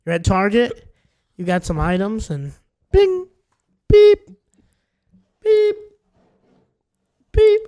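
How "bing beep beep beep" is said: high, loud beeping like a checkout scanner; scanner beeps. Pretend. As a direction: beep out each item as a barcode scanner. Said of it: "I'm the cashier".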